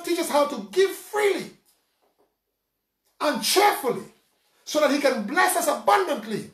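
impassioned preaching. Acted. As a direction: preach it out to the congregation. Preacher raises pitch, full force.

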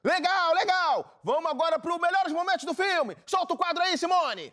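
voz alta e aguda de programa de auditório; chamada empolgada de apresentador. Acted. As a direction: chame o quadro com toda a empolgação. (apresentador animado, gritando pra produção)

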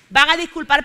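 ordinary talk

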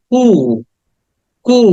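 কু with a stretched "oo", no final p; long vowel. Shown as a demonstration. Teacher shows the silent p, long drawn vowel. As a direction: hold the vowel of কু, "kooo". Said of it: "কিন্তু উটাকে টেনে বলতে".

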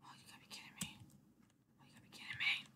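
very soft, voiceless grumble; disbelieving whisper. Real, not acted. Disbelief after failing in the game.

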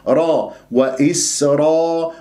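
وإسرا slowly, alif as heavy as the ر; full dark raa, lips unrounded; heavy open ra. Shown as a demonstration. Teacher restating the right reading, slow heavy ra. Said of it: "والألف تفخم بعدها تبعًا للراء".

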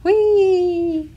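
high, loud, sailing whee; gleeful whee. A narrator's reading. Reader voices feather taking off, gleeful whee. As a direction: squeal it as if flying off.

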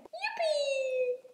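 high, drawn-out cheer; gleeful whoop. Acted. Friends tumbling down the hill, whooping. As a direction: whoop as you roll.

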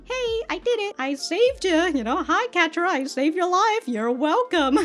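high-pitched self-congratulating chirp; hammed-up, high voice claiming credit; eager background character. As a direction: pipe up proudly from the background. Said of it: "in the background".

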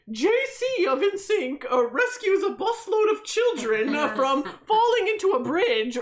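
a hint of a TV newsreader; mock news anchor. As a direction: announce it like breaking news, lightly hammed.

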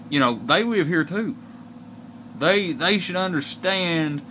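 ordinary talk

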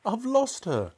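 high, dismayed cry. A narrator's reading. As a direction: cry it in distress. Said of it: "cried Missus Cranky".

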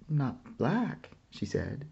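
usual speaking voice

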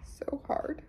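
breaking whisper. Real, not acted.

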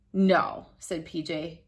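plain reading voice

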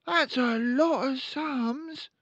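put-on high, loud little boy; young son voice. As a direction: squeak it like an eager son.

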